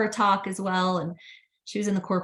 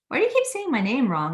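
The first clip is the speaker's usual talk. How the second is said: higher-pitched, quicker mock complaint; imitating exasperated student; 7 semitones higher, 4 dB louder, faster than usual. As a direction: lightly act out a student's protest. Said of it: "he would always be like".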